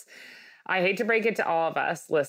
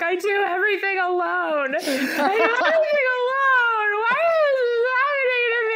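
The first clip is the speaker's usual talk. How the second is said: very high, loud wail building to a shriek; hammed-up sobbing meltdown, half laughing; mock hysterical crying; 5 dB louder than usual. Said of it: "I'm getting more and more hysterical".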